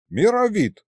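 shorter, earnest high address; very serious address. A narrator's reading. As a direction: say the name with grave urgency. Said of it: "Очень серьёзно обратился к нему лиховид". (очень серьёзно)

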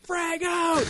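high, loud war cry; battle yell. Retold or imitated. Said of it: "you just hear him, like".

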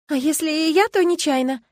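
high, loud insistent excuse; earnestly convincing. A narrator's reading. Girl excuses herself to Kastro, trying hard to convince. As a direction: plead your case with all possible conviction. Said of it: "как можно больше убедительности".

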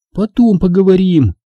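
high-pitched character voice for a woman; Angela's voice. A narrator's reading. Angela brushing off the narrator, high voice.

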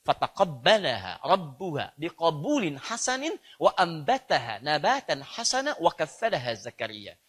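slow, measured Arabic chant; Quran recitation. Acted. Preacher recites a verse to the congregation, slow Quran recitation. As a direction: recite the verse slowly with drawn-out syllables.

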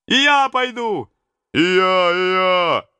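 high, loud eager shouts; many voices jumping in at once; shouting crowd. Acted. Robbers to the prince, high eager shouts. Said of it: "закричали многие".

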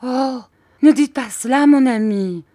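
wife pleads with her cold husband; pleading tone. A narrator's reading. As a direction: beg him not to say it. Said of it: "d'un ton suppliant".

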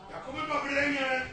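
high and far off, muffled from upstairs; calling from the attic. Acted. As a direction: shout down from the attic above. Father answers from the attic.

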